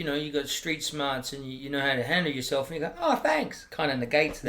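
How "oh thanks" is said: high-pitched put-on gratitude; mock thanks. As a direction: pretend to be flattered, pitched well up. Mock reply to a backhanded compliment.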